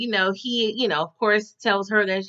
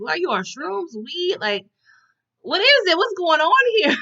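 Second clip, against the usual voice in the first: mock disbelieving grill; 7 semitones higher than usual. Teller confronting Vanessa, retold.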